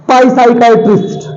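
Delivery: psychiatrist with an extra "pai" in front, drawn out slowly; wrong pronunciation. Shown as a demonstration. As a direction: psychiatrist as slow "pai-sai-kai-trist". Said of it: "This is not".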